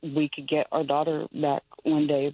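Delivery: ordinary talk, nothing marked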